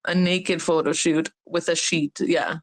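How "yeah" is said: normal talking voice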